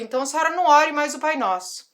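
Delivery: firm rebuke. Retold or imitated. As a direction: lay down the final word, stern and loud. (repreensão firme e mais alta à diretora)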